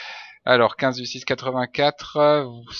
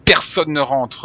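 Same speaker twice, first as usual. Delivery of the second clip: louder, barked ban; loud guard decree; 3 semitones higher, 7 dB louder than usual. Acted. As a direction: slam the rule down like a strict guard. Guard declares nobody enters.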